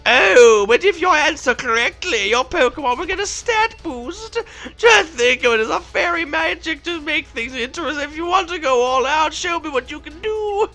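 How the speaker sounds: put-on high old granny voice. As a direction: play a theatrical old granny. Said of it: "Do you like my granny voice?".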